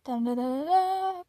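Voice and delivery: vocal drum roll, building to a big high finish; mock drum roll. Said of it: "drum roll please".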